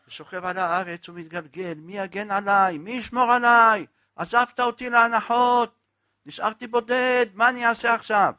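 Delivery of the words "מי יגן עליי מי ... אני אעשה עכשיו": higher, louder mourning cry; wailing lament. Acted. Penitent wails at his rabbi's funeral, loud grieving lament. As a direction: wail over the grave, abandoned and lost. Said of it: "והיה צועק".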